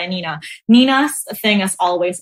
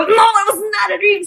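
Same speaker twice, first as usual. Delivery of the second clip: alarmed realization; 11 semitones higher than usual. Retold or imitated. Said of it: "And I go".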